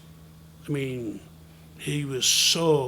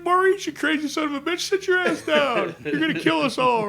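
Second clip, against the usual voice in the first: high, loud mock-panicked yell; alarmed scolding; 13 semitones higher, 7 dB louder than usual. Passengers beg Maurice to sit down, yelling in alarm. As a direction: order the madman back to his seat.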